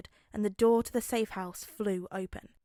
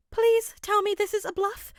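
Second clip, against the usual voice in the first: a much higher, louder desperate plea; pleading; 11 semitones higher, 8 dB louder than usual. Acted. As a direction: beg him to say it isn't real. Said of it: "Nezu pleaded".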